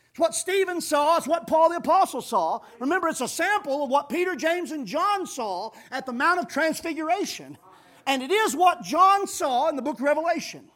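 loud, high, building preacher's call; preacher lifting the room; full-force preaching. Acted. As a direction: ring it out to the congregation. Preaching climax list.

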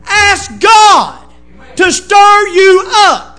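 slow, shouted exhortation, each word driven home; preaching at full force. Acted. Preacher urging the church to pray, shouting slowly. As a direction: pound it out to the congregation.

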